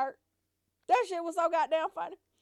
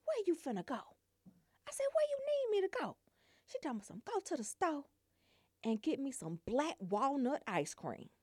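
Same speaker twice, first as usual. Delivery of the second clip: hushed; 10 dB quieter than usual. Retold or imitated. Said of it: "she don't want nobody else to hear".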